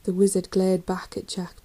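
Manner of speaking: usual speaking voice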